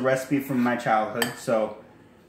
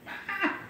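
startled yelp; 6 dB quieter than usual. Real, not acted. Startled while cooking.

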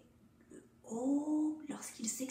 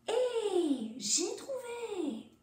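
high and stretched out, delighted; eureka; 7 semitones higher, much slower than usual. Shown as a demonstration. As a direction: sing out the discovery. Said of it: "lorsqu'elle sait". (« Eh, j'ai trouvé ! » aigu et ravi)

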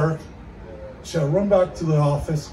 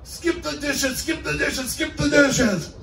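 high frustrated singsong chant; frustrated repeating; 9 semitones higher, slower than usual. Acted. Fed-up delivery guy yelling at the door, high and frustrated. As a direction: chant it in rising exasperation. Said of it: "totally frustrated".

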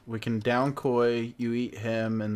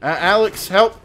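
calling for help; 7 semitones higher, 10 dB louder than usual. Real, not acted.

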